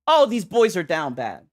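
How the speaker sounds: normal talking voice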